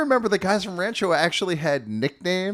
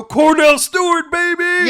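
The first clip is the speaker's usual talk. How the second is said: high excited shout; loud cheer; 13 semitones higher, 9 dB louder, slower than usual. Retold or imitated. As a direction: yell it like a touchdown celebration. Imitating a player's end-zone cheer.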